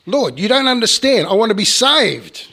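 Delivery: loud, high, insistent plea; pleading protest. Retold or imitated. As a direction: protest loudly from the cliff edge. Man protests to the Lord.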